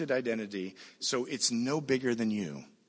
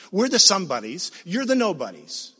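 loud, higher, mock-indignant taunt; scornful mob; 6 semitones higher, 10 dB louder than usual. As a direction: throw it out loudly as the jeering crowd. Preacher voices Sodom's mob scorning Lot.

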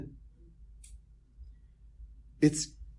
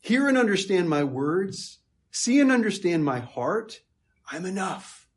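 louder, pleading voice of Jesus to Philip; 6 semitones higher, 4 dB louder than usual. Retold or imitated. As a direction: urge Philip earnestly to listen. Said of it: "he's saying".

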